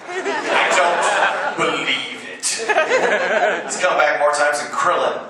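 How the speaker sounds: mock disbelief through laughter; amused, chuckling mock outrage; laughing. Real, not acted. Joking about endless comebacks.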